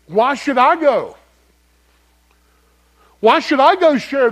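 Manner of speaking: Jonah's angry complaint. Retold or imitated. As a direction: complain to God like a sulking prophet. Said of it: "He's mad, mad about God's loving kindness".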